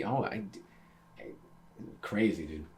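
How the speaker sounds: usual speaking voice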